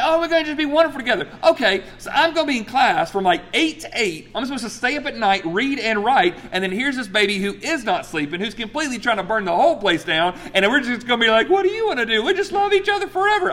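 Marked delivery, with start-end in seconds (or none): 0.0-1.3 high, rushed mock-gushing; mock rosy optimism. Preacher mocking his family's hopeful plan, mock rosy optimism. 11.5-13.5 very high, put-on sweetheart voice; mock lovey-dovey. Preacher sending up a cosy couple's chat, mock lovey-dovey.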